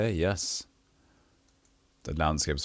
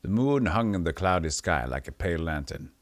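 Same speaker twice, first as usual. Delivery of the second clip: ordinary talk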